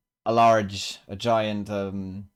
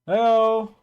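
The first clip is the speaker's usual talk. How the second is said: loud, much higher-pitched shout; calling back; 13 semitones higher, 9 dB louder than usual. Acted. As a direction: holler back to someone who yelled to you. Peter answers a yelled greeting.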